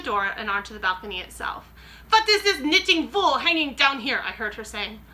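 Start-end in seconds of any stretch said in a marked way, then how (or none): 2.1-4.2 high, loud suspicious witch. Acted. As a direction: sniff out the intruder like a witch. Grand High Witch asks suspiciously.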